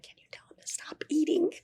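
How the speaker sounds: breathy whispered plea to the producer; hushed secret request. Retold or imitated. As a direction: whisper it so the mic won't catch it. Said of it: "I was like".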